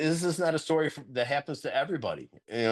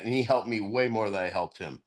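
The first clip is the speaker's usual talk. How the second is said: ordinary talk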